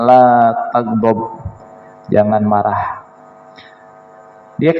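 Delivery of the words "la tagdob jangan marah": low, slow and measured; slow solemn advice. Retold or imitated. Preacher voicing the Prophet's answer, slow and grave. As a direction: let each word of the warning land slowly. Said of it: "Kata Rasul".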